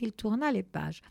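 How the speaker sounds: plain reading voice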